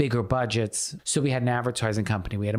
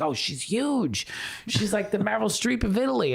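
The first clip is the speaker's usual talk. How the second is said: awed stage manager; 10 semitones higher than usual. Retold or imitated. Stage manager just learned who she is, awed. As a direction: gush over the news like it's enormous.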